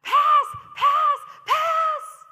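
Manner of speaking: put-on high, full-volume child's yell for the ball. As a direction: yell it out over the pitch, very high. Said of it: "he began yelling".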